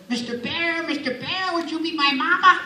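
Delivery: small, high, soft cartoon duckling; Yacky Doodle. Little duck following Yogi asks for a mama, soft high voice. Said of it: "kept following Yogi around and kept saying".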